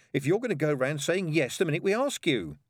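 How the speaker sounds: put-on high-pitched scolding girl; mildly severe child's reproach. A narrator's reading. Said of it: "she added severely".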